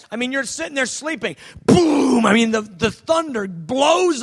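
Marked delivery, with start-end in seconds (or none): 1.6-2.2 boom. Acted. Sound effect of thunder waking.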